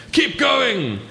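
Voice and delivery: high loud panicked order; Hillary urges Tenzing on, high panicked shout; urgent shout. Acted. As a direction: yell it to keep him climbing.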